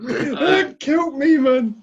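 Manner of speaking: high, slow squeal through laughter; laughing falsetto. Real, not acted. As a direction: squeak it out cracking up. Laughing reliving the clip.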